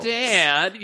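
high-pitched mock plea; pleading. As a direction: whine it at your dad, pleading. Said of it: "you plead".

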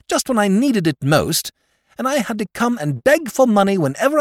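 high, loud complaining rant; aggrieved outburst. Acted. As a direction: pour out years of grievance. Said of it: "he cried".

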